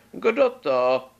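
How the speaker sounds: grandma calling. A narrator's reading. Grandma calls through the door to the knock, high and loud. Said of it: "ozval se uvnitř hlas".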